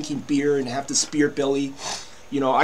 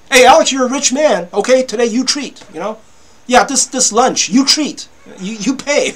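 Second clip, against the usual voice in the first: loud, high mock stepmom ordering him to pay; stepmom demanding; 7 semitones higher, 7 dB louder than usual. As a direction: play the pushy stepmom, higher and louder.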